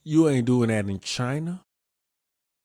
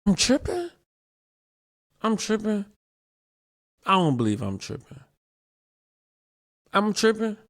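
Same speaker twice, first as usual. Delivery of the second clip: disbelief; 10 semitones higher than usual. Real, not acted. Stunned at his own claim.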